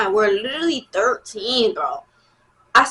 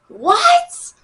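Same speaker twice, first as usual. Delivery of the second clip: surprised; 6 dB louder than usual. Real, not acted. Reacting to a jealous question.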